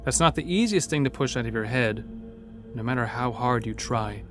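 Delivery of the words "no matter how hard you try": ordinary delivery, nothing marked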